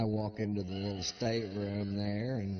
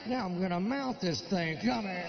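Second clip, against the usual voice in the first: manic excitement; 6 semitones higher than usual. Acted. Excited post-Viagra rant.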